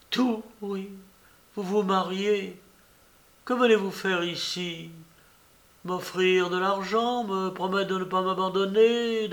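wounded defiance. Acted. Jilted woman confronts lover.

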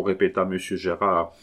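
usual speaking voice

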